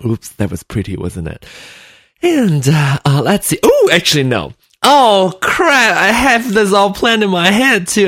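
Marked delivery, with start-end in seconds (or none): 4.9-8.1 surprised. Real, not acted. Forgot planned intro.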